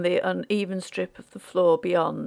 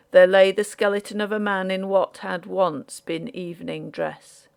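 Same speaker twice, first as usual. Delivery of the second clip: usual speaking voice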